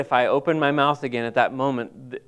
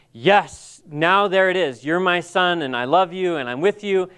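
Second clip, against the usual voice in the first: raised, emphatic declaration of love; imagined affirming God; 4 semitones higher than usual. Retold or imitated. God affirming a son in an imagined moment, emphatic. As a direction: voice a father's resounding yes, higher and firm.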